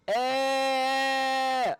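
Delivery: high, held squeal. Real, not acted. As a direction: hold a long high note on one vowel. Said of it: "it's a higher tone".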